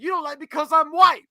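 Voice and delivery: high, loud accusing shout; heated yell. Retold or imitated. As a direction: throw the accusation back hard. Repeating the yelled accusation.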